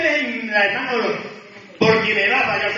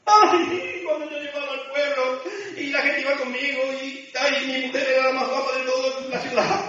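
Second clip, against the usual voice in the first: high-pitched exaggerated reminiscing, opening on a big "ay"; hammed-up pining for past glory; mock nostalgia; 5 semitones higher than usual. Parody of someone living in past. (nostalgia exagerada y burlona, en tono agudo)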